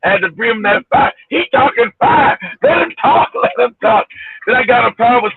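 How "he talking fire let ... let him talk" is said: cheering crowd. Retold or imitated. Imitating Nigerian panel cheering.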